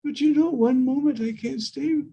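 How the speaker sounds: a hint of a tender maiden's voice; Radharani pleading. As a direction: beg him not to leave.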